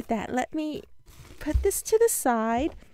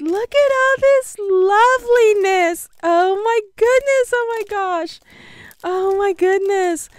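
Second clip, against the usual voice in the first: excited gushing; 6 semitones higher, 7 dB louder than usual. Real, not acted. Admiring the gifts.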